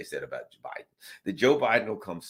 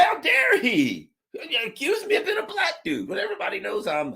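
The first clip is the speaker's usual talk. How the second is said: mock outrage; 4 dB louder than usual. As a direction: fake being scandalised, half laughing. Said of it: "And I say".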